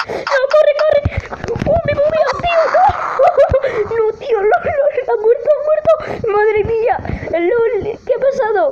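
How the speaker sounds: very high, frantic yelling; high-pitched gamer panic; panicked shrieking. Real, not acted. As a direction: shriek in disbelief as everything goes wrong. Fleeing, sudden death in game.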